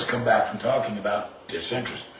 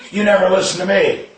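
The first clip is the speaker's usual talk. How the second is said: higher, louder scolding yell; angry yell; 7 semitones higher, 7 dB louder than usual. Retold or imitated. Angry parent yelling at the son after the crash. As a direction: lash out at the kid.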